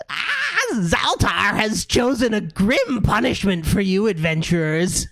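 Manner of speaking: high, sneering gargoyle voice; giggling gargoyle. Acted. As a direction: cackle out the warning like a gleeful stone gargoyle. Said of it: "And one says".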